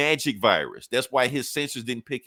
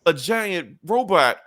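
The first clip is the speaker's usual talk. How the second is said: high, drawn-out fake panic; mock scared; 10 semitones higher, slower than usual. Tony facing a giant robot, mockingly terrified. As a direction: ham up a sarcastic show of terror.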